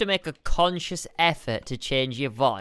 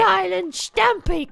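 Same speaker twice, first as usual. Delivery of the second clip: mock rallying cry; 14 semitones higher, slower than usual.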